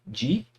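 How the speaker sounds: "Di" said alone as a separated syllable; single syllable. Shown as a demonstration. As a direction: cut off "Di" clean on its own. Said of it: "Cada vogal ficou numa sílaba diferente". (sílaba "Di" isolada)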